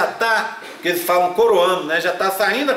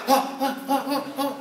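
mock labour moaning; 7 semitones higher, 5 dB quieter than usual. Women moaning in labour ward.

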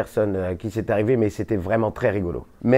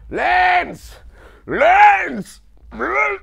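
high, strained yell close to gagging; straining shout; 5 dB louder, much slower than usual. Retold or imitated. Retelling the actor in the booth, straining yell. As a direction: yell till the voice cracks. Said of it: "il se lance".

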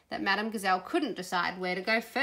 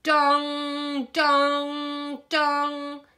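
loud, sustained booming "dong"; high, ringing held notes imitating chimes; Big Ben chime; 7 semitones higher, 7 dB louder, much slower than usual. A narrator's reading. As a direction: toll it out like a great clock bell. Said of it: "That's the sound of Big Ben".

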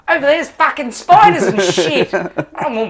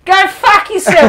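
loud, high furious outburst; angry shout; 10 semitones higher, 8 dB louder than usual. Real, not acted. Player cursing his friend Rick, loud angry shout. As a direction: blast it at your friend.

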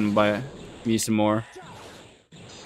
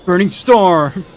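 shouted attack name, higher and louder; move callout; 5 semitones higher, 7 dB louder, slower than usual. Real, not acted. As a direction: yell the special move like an anime hero. Calling out special move.

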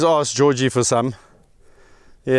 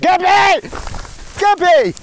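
high, loud shout of a name; calling out; 4 dB louder than usual. Real, not acted. Keeper calling naughty Gabby back, loud high shout.

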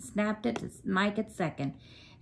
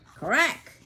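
bat crack; 6 semitones higher, 3 dB louder than usual. A narrator's reading. Bat hitting the ball.

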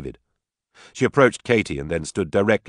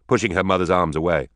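ordinary delivery

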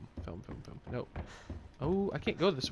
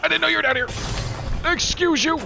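high, loud yelp of alarm; startled panic; 11 dB louder, slower than usual. Real, not acted. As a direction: cry out at a sudden ambush. Startled by enemy in game.

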